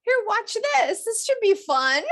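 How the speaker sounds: cheery naive parent. Pretend. Speaker voicing parents putting on her show for kids. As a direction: sell it like a clueless happy parent. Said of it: "parents would think".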